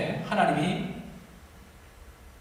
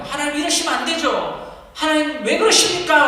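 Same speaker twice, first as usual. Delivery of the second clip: loud, higher pleading protest; angry prayer; 9 semitones higher, 6 dB louder than usual. Acted. Believer shouting a protest at God in anger. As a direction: demand an answer from God, voice rising. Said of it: "하나님을 향해서 분노할 때".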